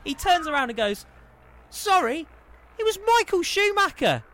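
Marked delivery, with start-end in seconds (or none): 1.7-4.2 very high, loud punchline; astonished reveal. Retold or imitated. Storyteller revealing whom he nearly hit, amazed. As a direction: belt out the big reveal, incredulous.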